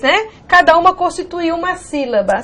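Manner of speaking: ordinary talk, nothing marked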